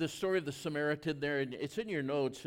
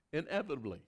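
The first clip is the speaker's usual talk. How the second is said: ordinary talk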